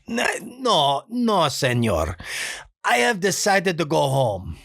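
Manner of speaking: put-on high, slow pleading Spanish-accented plea; a nice guy begging off in a thick Spanish accent; hint of dubbed Spanish accent; Benito voice. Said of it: "more senor for the accent there".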